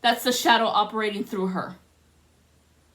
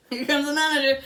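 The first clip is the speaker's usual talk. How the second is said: laughing as you repeat it; amused, chuckling through it; 3 semitones higher, faster than usual. Real, not acted.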